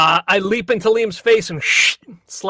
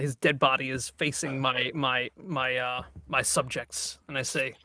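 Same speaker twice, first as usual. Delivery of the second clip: usual speaking voice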